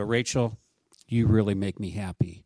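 low, stern and joyless; mock-stern praise said low, without warmth. Shown as a demonstration. Pastor shows a friend a stern, joyless compliment. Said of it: "I'm very stern and I go".

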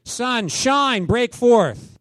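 loud, high command to the weather; commanding shout. Retold or imitated. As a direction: order the snow to stop at full voice. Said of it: "I just said".

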